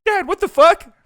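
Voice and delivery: put-on loud, squeaky kid voice; outraged kid. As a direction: play a little boy crying out to his dad in disbelief. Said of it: "Little Timmy can't believe it".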